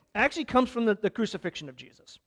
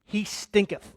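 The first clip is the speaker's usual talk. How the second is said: King James English; slower than usual. Retold or imitated. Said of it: "in the old King James".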